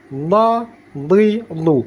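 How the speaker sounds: "Dha, dhi, dhu" heavy, from the tongue's back edge; thick D, tip behind upper teeth, in "Dha, dhi, dhu"; side-of-tongue dhad. Shown as a demonstration. Teacher showing placement, "Dha, dhi, dhu" pressed from the tongue side. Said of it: "Fokusnya kita pinggir pangkal".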